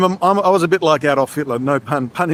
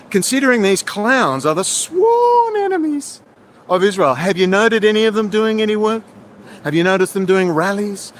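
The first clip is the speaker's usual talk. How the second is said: ordinary talk, nothing marked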